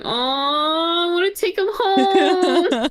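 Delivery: high, slow cooing plea; cooing over animals. Retold or imitated. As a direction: melt over a puppy.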